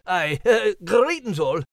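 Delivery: a rough and ready Scottie with a Scottish burr; Scottish dog. Acted. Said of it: "our rough and ready Scotty dog".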